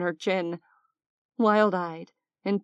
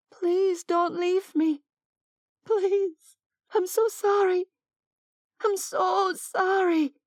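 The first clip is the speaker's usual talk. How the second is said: high, slow, broken plea; tearful pleading; 12 semitones higher, slower than usual. Acted. Oksana pleads with dying Mason, high tearful begging. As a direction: beg him not to go, voice lifted high and slow.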